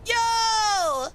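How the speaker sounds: loud, high greeting yell; excited shout. Real, not acted. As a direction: call out to the raiders.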